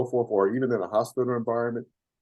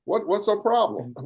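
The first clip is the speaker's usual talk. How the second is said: exasperated complaint; 10 semitones higher, 4 dB louder than usual. Retold or imitated. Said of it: "I said".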